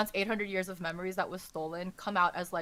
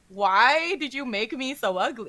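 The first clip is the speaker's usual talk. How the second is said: mock indignant whine of protest, far from usual voice; offended; 2 semitones higher, 4 dB louder than usual. Prince protests his ugly statue, offended. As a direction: act out an affronted complaint. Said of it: "like, so offended".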